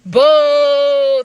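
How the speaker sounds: loud jeering boo; mock boo. Real, not acted. Narrator booing his date's lame response, loud jeer. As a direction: boo her reply like a crowd. Rejecting her answer.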